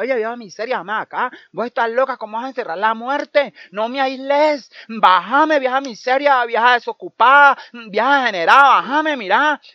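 high, loud furious pleading, drawn out; trapped Death yelling. Acted. Death stuck in the tree yelling at the old woman. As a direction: scream to be let down.

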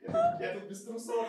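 high slow laughing joke; giggling confession. Real, not acted. Jokes laughing, slowly.

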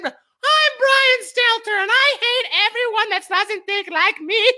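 mock TV host voice; mocking Brian Stelter. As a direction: squeak it like a prissy caricature. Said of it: "C N N".

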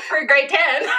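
high and loud through laughter; cracking up. Real, not acted. Teacher confessing an embarrassing typo, helpless laughter. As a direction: barely get it out for laughing.

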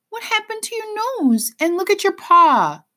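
very high, distressed exclamation; crying out. A narrator's reading. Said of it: "she cries".

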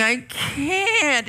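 high, whining I can't; whiny kid. Pretend. Teen whining back to parents, high and whiny. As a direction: play the sulky teen.

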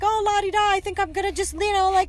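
high, singsong mock kid voice; imitating a carefree five-year-old; bored child. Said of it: "He got bored".

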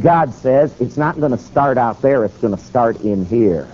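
ordinary delivery

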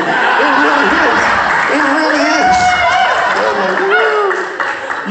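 drawn-out joyful it really is; joyful amazed exclaim. Retold or imitated. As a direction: burst out in happy disbelief. Retelling joyful healing.

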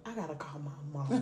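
low, soft, quick aside; hushed inner thought. Retold or imitated. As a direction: say it under your breath, as if thinking aloud. Retells own dread-filled thought.

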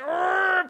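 high straining grunt of effort. Retold or imitated. Thor strains with all his might to lift the cat. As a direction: strain as if lifting something huge. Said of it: "Y otra vez".

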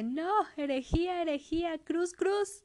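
high, loud, horrified cries of heresy; horrified priest. Acted. Priest finding the drowned lovers, horrified high shouts. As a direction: cry heresy like a scandalized priest.

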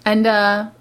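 usual speaking voice

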